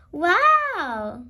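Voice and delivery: loud, delighted wow; excited cry. Acted. Peppa sees the bigger plane: loud excited wow. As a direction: gasp at the big plane. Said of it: "cries Peppa".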